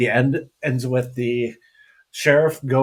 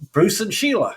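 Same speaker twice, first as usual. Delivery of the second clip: mock Australian; 8 semitones higher than usual. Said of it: "go to Australia as".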